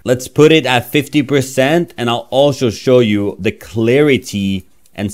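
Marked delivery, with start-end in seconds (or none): none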